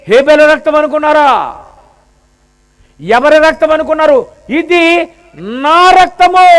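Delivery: high, loud rising preach on రక్తము. Acted. Preacher to congregation, loud rising cry. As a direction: build to a ringing cry on నా రక్తము.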